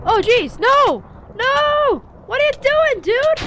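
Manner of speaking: high frantic shouting at a fly; panicked yelling. Real, not acted. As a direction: yell in panic, very high and loud.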